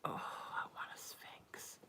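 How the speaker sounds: slow whispered longing; whispered wistful wish. Real, not acted. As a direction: breathe the wish out slowly. Longing aside about a cat.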